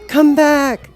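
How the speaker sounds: put-on a girl left behind calling; mild scream. A narrator's reading. Dorothy cries after the rising balloon, high-pitched. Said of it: "she screamed".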